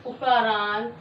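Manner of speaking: a word or two, then a very long pause; halting, drawn-out word-by-word delivery; slow dictation. Shown as a demonstration. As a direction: dictate piece by piece for someone writing along. Dictating definition while writing it out.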